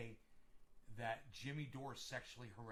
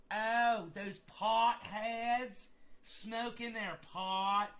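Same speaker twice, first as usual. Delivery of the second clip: higher, louder, slow outraged host voice; Nancy Grace impression; 7 semitones higher, 8 dB louder, much slower than usual. Mocking Nancy Grace ranting about potheads, outraged. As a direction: sneer at the potheads like a scandalized TV host. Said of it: "her voice?".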